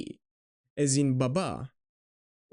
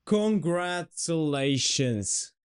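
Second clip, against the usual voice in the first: celebratory cheer; 2 semitones higher, slower than usual. Pretend. Teacher congratulating learners on knowing all the Greek letters, celebratory. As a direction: cheer them on, stretching the words.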